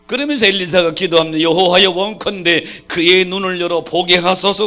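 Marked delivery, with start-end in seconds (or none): 1.4-4.6 loud, high fervent plea; fervent prayer. Retold or imitated. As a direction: cry out to God. Elisha prays aloud.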